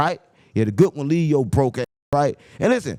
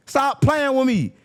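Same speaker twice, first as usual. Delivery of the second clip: higher, slow, each word stretched; drawn-out rebuke; 10 semitones higher, slower than usual. Real, not acted. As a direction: drag it out to end the argument.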